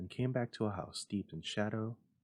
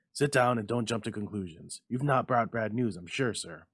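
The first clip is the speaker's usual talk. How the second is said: hasty; 2 semitones higher, 7 dB louder than usual. A narrator's reading. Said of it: "he said hastily".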